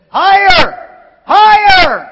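slow, loud rising shout. Retold or imitated. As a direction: cheer them upward. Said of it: "Wilbur Orville's dad said".

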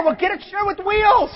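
high shouted comeback; shouted retort. Real, not acted. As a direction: fire back at the excuse. Preacher's retort.

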